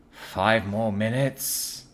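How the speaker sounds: slow, drowsy mumble; sleepy groan. Acted. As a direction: drag it out half asleep. Tom groaning awake from sleep.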